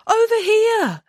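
put-on very high girlish call; high, carrying cry. A narrator's reading. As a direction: call across the room to be noticed. Samantha calls to be found.

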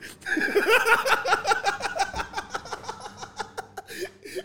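squealing laugh that keeps going; real uncontrollable laughter; long high laughing fit. Real, not acted.

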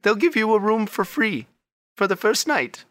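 high, bright-voiced lady shopkeeper. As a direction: gush over their outfits in a high lady voice. Shop lady gives her name.

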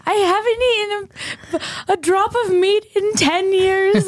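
mock bawling, very high and drawn out; slow, high-pitched imitation of a wail; Gail. Upset vegetarian at dinner, exaggerated mock crying. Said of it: "Gail starts bawling her eyes out".

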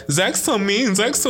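high mocking whine; piping mock voice; mocking whiny critics. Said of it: "people are always like".

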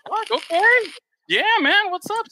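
high surprised laugh. Retold or imitated. Retelling surprise at backstage wristband.

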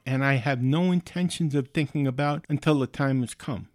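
usual speaking voice

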